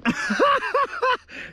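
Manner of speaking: a high, breathy burst of laughter; delighted laugh. Real, not acted. Camper laughing at finding the stranger's wine. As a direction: laugh in happy disbelief.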